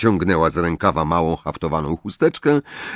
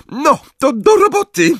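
high, loud, shrill cry; shrill hysterical shout; 6 dB louder than usual. A narrator's reading. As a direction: shriek it at the class. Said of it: "krzyknęła piskliwym, nieco histerycznym głosem". (piskliwy, histeryczny okrzyk)

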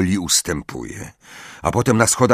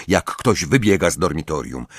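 ordinary delivery, nothing marked

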